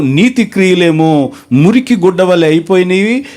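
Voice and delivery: ordinary talk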